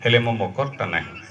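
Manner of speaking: low, hushed lament in verse; sorrowful refrain. Acted. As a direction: drop low and soft, grieving the absent friend. Poem's refrain of loss. (ଧୀର, ଦୁଃଖଭରା ସ୍ୱରରେ)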